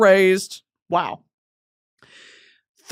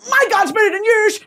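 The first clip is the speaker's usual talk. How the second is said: angry; 12 semitones higher, 3 dB louder than usual. Pretend. Acted holy war quarrel.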